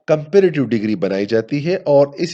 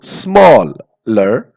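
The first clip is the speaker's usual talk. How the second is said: Smaller stretched slowly, each sound drawn out; drawn-out word; 4 semitones lower, 4 dB louder, much slower than usual. Shown as a demonstration. Teacher drawing out smaller slowly for students. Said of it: "देखो".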